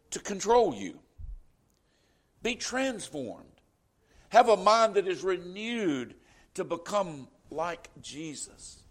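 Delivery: ordinary delivery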